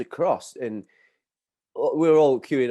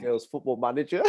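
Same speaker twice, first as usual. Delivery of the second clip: ordinary talk, nothing marked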